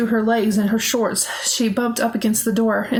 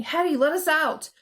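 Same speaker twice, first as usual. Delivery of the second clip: raised, urgent shout; 6 semitones higher, 3 dB quieter than usual. A narrator's reading. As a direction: call out urgently to be let out. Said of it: "Renee shouted suddenly".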